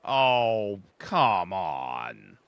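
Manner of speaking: ordinary talk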